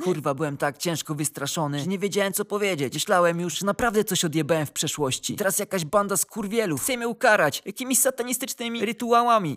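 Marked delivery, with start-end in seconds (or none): none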